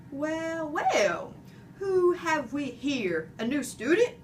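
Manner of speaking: a hint of a big booming janitor; slow, hearty greeting to a small pet; booming man's voice. A narrator's reading. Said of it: "a voice boomed".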